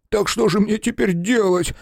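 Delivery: high, whiny whimper. A narrator's reading. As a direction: whine it like a scared man. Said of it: "захныкал фотограф".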